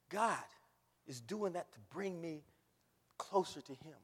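ordinary talk

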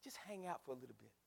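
very soft, unforced invitation; gentle Jesus. Acted. Preacher as Jesus inviting believers to linger, soft and gentle. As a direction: drop right down to a quiet coaxing. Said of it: "Jesus says".

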